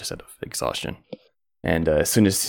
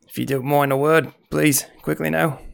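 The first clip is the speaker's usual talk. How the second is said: higher, hushed, hurried innkeeper; nervous innkeeper; 8 semitones higher, 3 dB louder than usual. Acted. Innkeeper to the adventurers, higher and nervous. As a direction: nervously draw the adventurers aside.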